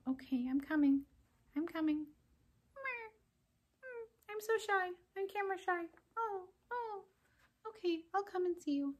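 a timid little puppet peeking out; shy puppet. Acted.

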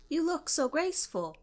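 high squawky parrot mimic, lightly done. Pet parakeet Beaujolais compliments the friends, high mock parrot voice. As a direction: chirp it like a pet bird repeating a phrase. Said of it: "he complimented us instead with".

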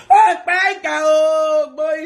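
slow teasing holler; drawn-out loud call. Real, not acted. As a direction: call it out long across the room. Calling out teasingly.

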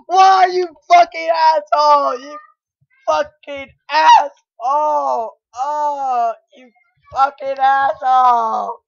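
real rage shout; furious yelling. Real, not acted. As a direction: bellow the insult in real anger. Rage at the game.

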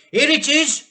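high, loud eager cry; excited cry. A narrator's reading. Mr Killiecrankie bursting into the kitchen, triumphant cry. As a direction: announce the find in triumph. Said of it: "cried Mister Killiecrankie".

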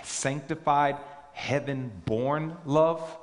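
preacher asks why he stresses the phrase; stress on sanctified. Shown as a demonstration. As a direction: draw out heaven-born love, slow and deliberate. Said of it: "why I'm emphasizing".

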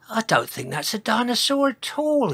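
high-pitched dinosaur character voice; Sizo. A narrator's reading. Dinosaur working out what the smoking river is, high voice. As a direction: speak up high as a doubtful dinosaur. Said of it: "he said".

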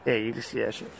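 breath steaming in cold air; shivery cold talk. Real, not acted. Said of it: "You can see my breath".

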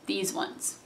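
hushed aside; whispered pointer. Real, not acted. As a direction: whisper it while showing. Pointing out her own tank top.

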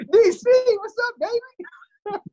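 high-pitched excited greeting. Retold or imitated. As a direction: call it out like a happy old friend. Greeting impression of friend.

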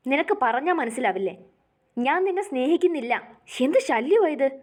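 annoyed husband waves off his wife's pleading, irritated; irritated scolding. Acted. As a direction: snap at her to stop pestering. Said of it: "അവൻ മുഖം ചുളിച്ചുകൊണ്ട് പറഞ്ഞു".

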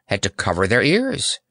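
ordinary delivery, nothing marked

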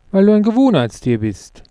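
usual speaking voice

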